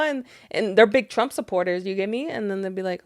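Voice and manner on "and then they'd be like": ordinary delivery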